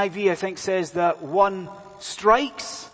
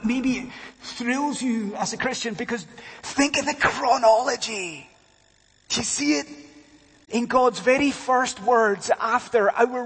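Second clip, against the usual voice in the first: high, impassioned pulpit proclamation; full-force preaching; 7 semitones higher, 3 dB louder than usual. Real, not acted. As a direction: build to a triumphant climax, pitch climbing. Climax of the sermon.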